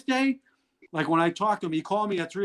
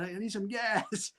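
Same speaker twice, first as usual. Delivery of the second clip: sheepish plea; 5 semitones higher, 7 dB quieter, slower than usual. Retold or imitated. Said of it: "I said".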